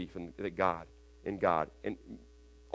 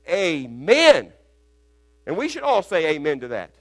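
louder, higher preaching; full-force preaching; 6 semitones higher, 8 dB louder than usual. Real, not acted. Rising preaching intensity.

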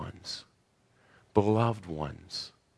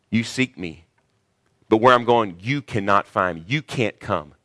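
firm and pointed, louder on where; stern rebuke; 5 dB louder than usual. Retold or imitated. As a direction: warn them off coldly. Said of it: "He didn't say it like that with the disciples".

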